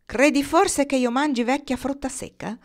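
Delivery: scornful. A narrator's reading. Crow chief refuses offered berries, scornful. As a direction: throw it back with contempt. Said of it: "ribatté con disprezzo".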